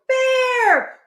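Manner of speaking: high-pitched wailing cry; panicked call. Acted. Hippo flees calling Bear, high panicked wail. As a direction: wail out for a friend in mock panic.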